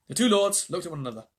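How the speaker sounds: ordinary delivery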